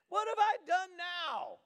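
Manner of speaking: high whine, dragged out slowly; high, slow whiny little brother. Retold or imitated. David whining to his big brother Eliab, high and slow. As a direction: whine like the picked-on youngest. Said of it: "But David said".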